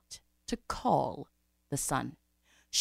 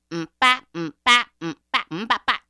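loud percussive "mm-ba" chant; rhythmic refrain; 3 semitones higher, 8 dB louder than usual. Acted. Storyteller beating out a drum refrain between lines, chanted. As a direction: beat it out like a drum.

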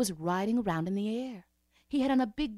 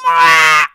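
loud furious yell; angry scream; 4 semitones lower, 22 dB louder than usual. Acted. The imp screams in fury. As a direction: let out a rage yell.

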